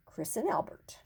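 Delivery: soft secret whisper. A narrator's reading. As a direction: breathe it like a secret. Quiet aside in story.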